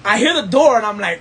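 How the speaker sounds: ordinary talk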